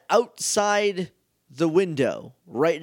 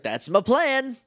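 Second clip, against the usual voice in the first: hammed-up villain gloating, pitched higher; a quick mock-evil boast; 8 semitones higher, slower than usual. Mondo voiced gloating.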